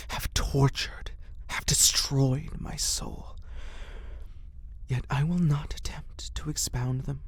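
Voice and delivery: ordinary delivery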